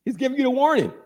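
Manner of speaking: normal talking voice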